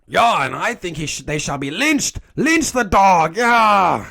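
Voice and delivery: high, loud rabble-rouser; hammed-up villager baying for blood; mock angry mob. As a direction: rile up the mob, building to a yell. Acts out angry lynch mob.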